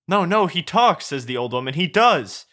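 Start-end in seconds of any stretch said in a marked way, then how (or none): 0.1-1.1 high-pitched, acted insistence; frantic protest. Old woman protests to guards. 1.7-2.5 high-pitched, lightly acted insistence; insistent protest. Old woman insists.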